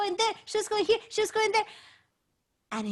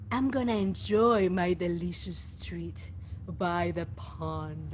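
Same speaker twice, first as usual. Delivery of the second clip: dragon voice; 9 semitones lower, 4 dB quieter than usual. Acted. The dragon gloats.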